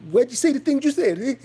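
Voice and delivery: high, loud mocking caller voice; squeaky put-on voice mocking the caller; imitating Special Kev. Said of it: "He talking about".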